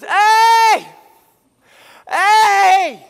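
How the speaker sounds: long, high shouted call; yelling. Acted. David waking Saul's camp from the hill, yelling. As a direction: holler across a valley to wake a camp. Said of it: "Who's yelling at us?".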